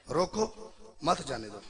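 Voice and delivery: रोको, short break, then मत जाने दो falling; slow order with रोको set apart; pause after रोको. Shown as a demonstration. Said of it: "लहजे का कितना फर्क पिया है". ("रोको" अलग, फिर "मत जाने दो")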